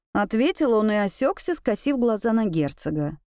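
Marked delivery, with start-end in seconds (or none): none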